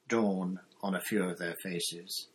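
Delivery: plain reading voice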